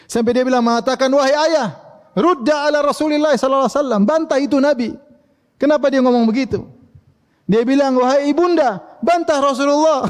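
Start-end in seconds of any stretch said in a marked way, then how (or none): 1.1-10.1 pleading Aisyah. Acted. Aisyah pleading with her parents to defend her, lifted high.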